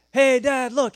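loud, high shout to Dad; son's distracting call. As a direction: call out suddenly to divert him. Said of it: "all he has to do is go".

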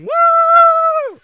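very loud drawn-out whoop; imitated wild yell while giving chase; high chasing whoop. Chasing the dog in chaos.

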